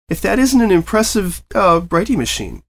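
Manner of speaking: high, fluttery wonder; marvelling at a strange gadget; amazed praise. Acted. Mare admiring gadget.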